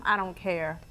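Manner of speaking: usual speaking voice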